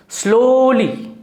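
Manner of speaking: very slow, stretched slowly; drawn-out slowly. Shown as a demonstration. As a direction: draw slowly right out. Acts out the adverb slowly.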